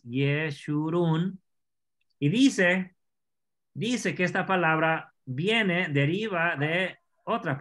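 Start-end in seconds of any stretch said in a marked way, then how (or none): 0.0-1.4 Yeshurún slowly, each syllable stretched, with sh; pronunciación hebrea. Shown as a demonstration. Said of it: "Aquí está otra vez más". (Yeshurún despacio, alargando cada sílaba, con sh)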